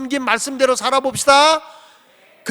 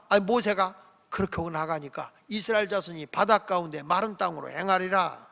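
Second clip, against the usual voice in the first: ordinary talk, nothing marked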